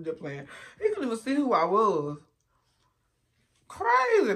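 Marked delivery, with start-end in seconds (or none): 3.7-4.3 high exclaim. Real, not acted. Exclaims about people.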